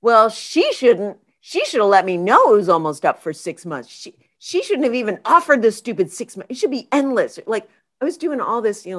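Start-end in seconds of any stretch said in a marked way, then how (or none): 0.0-7.4 louder, indignant blaming; angry rant. Retold or imitated. Blaming the friend for the expired package, louder indignant rant. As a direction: vent at her for the expiry.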